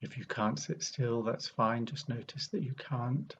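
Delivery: unhurried meditation guide with long pauses; guided meditation. Acted. Meditation teacher leading an arrival sit, calm guiding voice. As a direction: guide listeners gently, leaving long silences between phrases.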